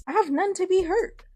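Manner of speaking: put-on blunt girlfriend retort. Friend's blunt retort in dialogue.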